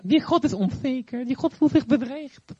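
snake voice. Acted. Snake slandering God.

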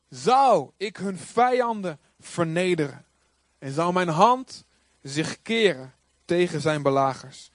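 slow, weighty phrases with pauses; declaimed scripture reading. Acted. Preacher reading the psalm to congregation, slow declamation. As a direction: proclaim it slowly, leaning on key words.

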